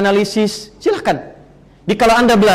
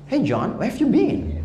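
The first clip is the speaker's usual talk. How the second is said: imitating an English speaker, slow and softer; English accent; 4 semitones higher, 6 dB quieter, slower than usual. Shown as a demonstration. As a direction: put on a drawn-out English accent. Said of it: "kayak orang Inggris".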